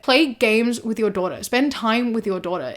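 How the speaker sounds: ordinary talk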